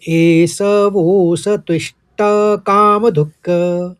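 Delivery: "एष वोस त्विष्ट कामधुक्" slow and loud, joined; joined pronunciation. Shown as a demonstration. Teacher shows the last quarter joined together. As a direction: join एष वोस त्विष्ट कामधुक् slowly and firmly. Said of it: "एक साथ बोलने में".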